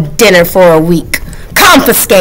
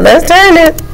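excited; 8 semitones higher than usual. Acted. Excited cry to the kids.

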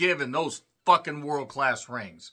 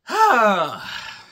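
loud sigh; 5 semitones higher, 8 dB louder than usual. Real, not acted. Weary reaction to self-destruction.

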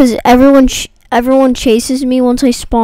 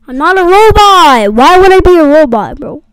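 raging gamer; 5 semitones higher, 4 dB louder than usual. Real, not acted. Indignant after losing.

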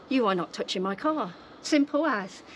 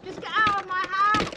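angry shout; 7 semitones higher than usual. Real, not acted. Ordering agents out.

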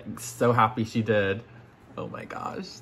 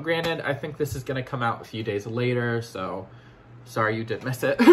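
normal talking voice

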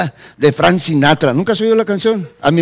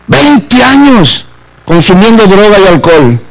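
loud, forceful sermon delivery; full-force preaching; 9 dB louder than usual. Real, not acted. Preacher exhorting churchgoers to serve and pray, loud fervent preaching. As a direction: preach it out loud to the whole congregation.

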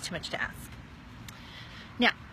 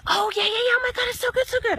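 high, louder, fast gushing friend voice; gushing girlfriend; 13 semitones higher, 8 dB louder, faster than usual. Retold or imitated. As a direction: play the friend bubbling with enthusiasm.

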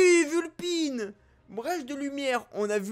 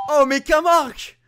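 loud startled exclamation; surprised shout; 6 semitones higher, 10 dB louder than usual. Real, not acted. Gamer startled by an opponent, loud shout. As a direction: cry out at the surprise.

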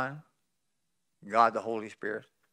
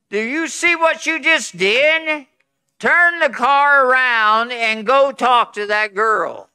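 higher, louder, slow commanding inner voice; a divine rebuke, spoken slow and loud; Spirit of God commanding; 7 semitones higher, 11 dB louder, slower than usual. Retold or imitated. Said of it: "the Spirit of God saying".

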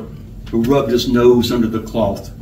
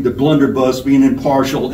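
plain reading voice

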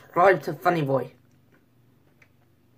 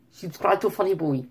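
imitation of a French accent; mock French accent; 3 semitones higher than usual. Shown as a demonstration. Vlogger running through accents for his plug, mock French. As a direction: put on a rough French accent.

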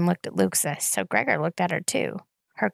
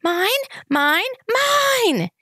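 piercing, much higher, loud shriek; 6 dB louder, much slower than usual. A narrator's reading. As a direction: rattle the eardrums. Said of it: "eardrum-piercing shriek".

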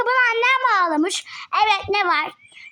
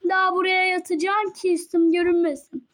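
normal talking voice

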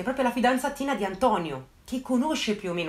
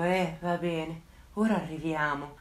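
slow, lower, reluctant mock sigh of annoyance; annoyed, evening ruined; 4 semitones lower, 4 dB quieter, slower than usual. Bored officer grudgingly agreeing to send help, slow and reluctant. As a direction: drag it out like you've been bothered at dinner. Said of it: "con un tono di chi gli ha guastato decisamente la serata".

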